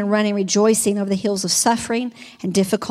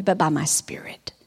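breathy whispered hush; softly whispered secret; 7 semitones lower, 7 dB quieter than usual. Acted. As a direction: drop to a whisper for God's words. God's words to Zerubbabel, hushed.

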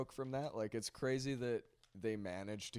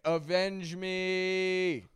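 mock dramatic yell; 9 semitones higher, 7 dB louder, much slower than usual. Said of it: "And he's like".

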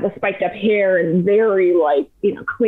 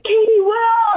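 yelling names; 13 semitones higher, slower than usual. Retold or imitated. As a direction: call out for your friends. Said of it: "I'm yelling".

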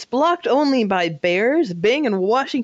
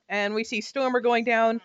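usual speaking voice

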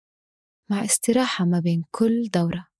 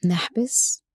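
slow, calm breathing cues; guided breathing; 4 dB quieter, slower than usual. Acted. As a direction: count the breath slowly and softly.

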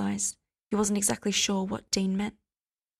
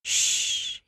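murmured hush. A narrator's reading. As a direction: soothe him with a hush. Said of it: "he murmured".

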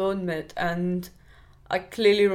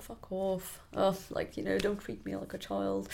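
a much softer, muttered comeback; muttered retort; 11 dB quieter than usual. Retold or imitated. To hosts who treated him like a child, muttered much softer. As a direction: mutter it under your breath, fed up.

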